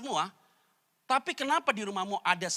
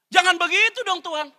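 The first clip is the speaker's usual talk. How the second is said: high, loud pleading cry; a wife crying out to heaven; wife's loud protest; 13 semitones higher, 12 dB louder than usual. Acted. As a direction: beg God to stop, loudly.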